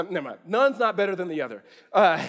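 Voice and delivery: normal talking voice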